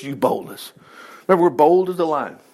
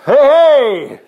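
loud, higher-pitched hailing shout; 7 semitones higher, 8 dB louder than usual. Acted. David hails Abner and Saul from the slope, shouting. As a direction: call out to people far below. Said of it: "David shouted down to".

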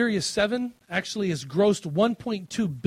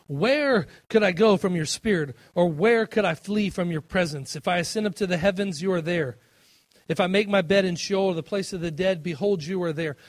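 measured, raised scripture reading; 5 dB louder than usual. Acted. Preacher reading a psalm to the congregation, raised and measured. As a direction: read the psalm out with weight. Reads scripture aloud in sermon.

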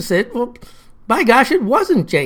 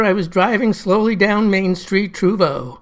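plain reading voice

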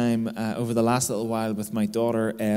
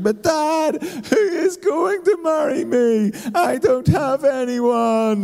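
very high, loud, drawn-out mock wail; hammed-up little-girl crying, stretching every word; imitated devastated child; 13 semitones higher, 5 dB louder, much slower than usual. Upset daughter on the sofa to Dad, imitated wailing. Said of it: "she's all upset now and she's like".

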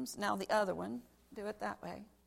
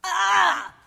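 much higher, loud mock scream mid-jump; playful shriek; 10 semitones higher, 16 dB louder than usual. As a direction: yelp as if falling toward the alligators. Jumping onto child, playful scream.

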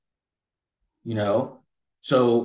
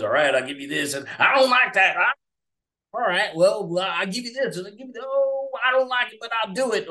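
higher, hammed-up old Southern grumble; mock Southern accent; 8 semitones higher than usual. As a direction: act out a grudging old Southern deal, pitched up. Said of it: "terrible Southern accents".

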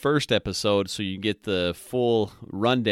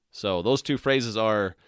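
ordinary talk

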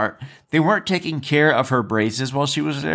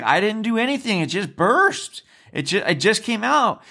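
high-pitched, defensive retold plea; protesting innocence; 7 semitones higher than usual. Blamed girl answering staff member, protesting in a higher voice. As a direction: imitate a girl insisting it wasn't her fault.

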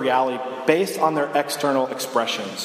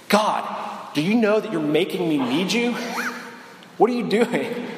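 exasperated prayer; 4 semitones higher than usual. Retold or imitated. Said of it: "I realize, like".

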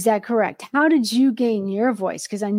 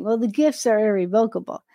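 normal talking voice

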